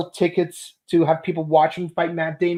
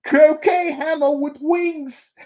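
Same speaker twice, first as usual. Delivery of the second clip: mock quote; 7 semitones higher, 4 dB louder, slower than usual. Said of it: "That's the funny line".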